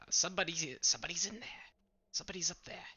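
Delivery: high, whispered alert; whispered warning. Acted. Player warns party in character.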